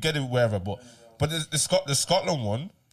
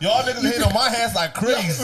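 higher, louder laughing brag; laughing mock boast; 6 semitones higher, 5 dB louder than usual. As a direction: boast it while cracking up. Mocking line with laughter.

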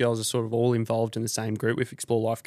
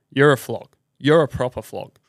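louder, scornful put-down; angry insult; 6 dB louder than usual. Real, not acted. Angry host telling a rule breaker he's a flog. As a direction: spit the insult out with contempt.

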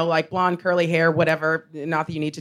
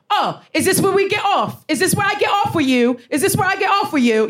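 put-on high-pitched drunken jeering; mock drunk heckler; 11 semitones higher than usual. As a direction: imitate an irritating drunk man, pitched way up.

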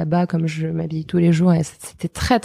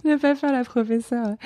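laughing; 6 semitones higher than usual. Real, not acted. Laughing while self-correcting.

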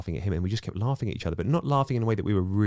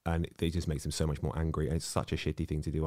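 normal talking voice

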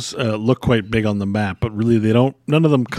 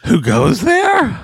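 wary hermit voice; 8 semitones higher than usual. Acted. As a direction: call out warily from inside. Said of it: "and you hear".

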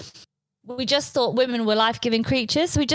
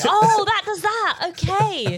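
much higher, slow dawning oh; mock discovery; 12 semitones higher, much slower than usual. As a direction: ham up a slow realisation.

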